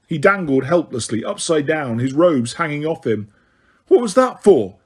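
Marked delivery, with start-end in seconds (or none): 3.9-4.8 loud furious bellow. A narrator's reading. Harry bellows at Ron after being punched, loud and furious. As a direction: roar it back at him. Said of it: "Harry bellowed".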